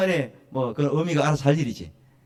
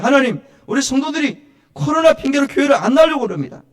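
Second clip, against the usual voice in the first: high, loud plea to God; raised praying voice, insistent and pleading; fervent dawn prayer; 12 semitones higher, 8 dB louder than usual. Acted. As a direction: cry out the petitions as in urgent prayer. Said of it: "저는 새벽마다 이렇게 기도해요".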